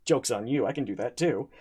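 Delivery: ordinary talk, nothing marked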